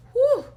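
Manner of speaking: high, loud "whew"; exasperated whew. Real, not acted. Venting about a coward, exasperated high whew. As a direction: blow off steam in a high whoop.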